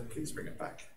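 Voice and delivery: hushed, timid mock plea; meek request. Norwegian renting out a boat, meek hushed plea. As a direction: say it small and very softly.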